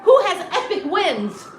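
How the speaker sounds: high, loud, bubbly greeting; peppy host. Retold or imitated. Ethics officer opening the class, loud peppy host voice. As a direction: greet the crowd like a cheerleader. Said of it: "But she would be like".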